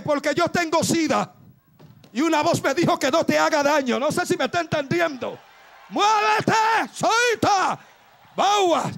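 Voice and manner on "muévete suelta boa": high shouted commands; commanding shout. Acted. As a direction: bark the orders to move. Preacher shouting commands at congregation.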